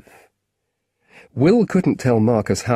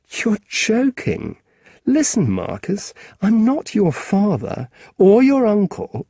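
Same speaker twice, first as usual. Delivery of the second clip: exasperated; 10 semitones higher than usual. Acted. Will raises voice, exasperated.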